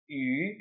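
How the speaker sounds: yu rising upward; second tone yu. Shown as a demonstration. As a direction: lift yu like a question. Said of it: "Read after me".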